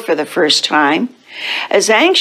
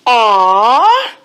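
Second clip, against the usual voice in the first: drawn-out, higher group moan; disappointed aww; 4 semitones higher than usual. Acted. Cats told there is no milk, disappointed aww. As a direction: let out a long aw of letdown.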